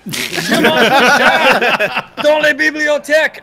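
slow, high, laughing French; hamming up schoolbook French; mock French. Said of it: "started saying all the random words I know".

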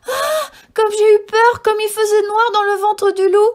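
put-on girl freed from the wolf, crying out in fear; crying out in fright. A narrator's reading. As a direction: shriek out the relief of escaping. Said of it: "en s'écriant".

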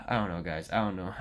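low, soft, resigned murmur after a sigh; helpless. Real, not acted. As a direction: say it low and defeated. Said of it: "at this point I feel helpless".

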